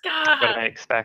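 high whiny mock sob; mock crying. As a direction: wail it in playful despair. His house again, mock despair.

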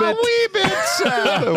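squeaky Irish lilt on wee bit; high mock Irish. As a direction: echo it high like a cheeky leprechaun.

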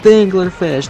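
high, loud repeated name; booming announcement. Acted. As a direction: boom the name out.

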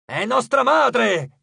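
high, loud, drawn-out protest; shouting. A narrator's reading. Titan defending his mother to his brother, shouting. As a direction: cry out in indignant defence. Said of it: "gridò Iperione".

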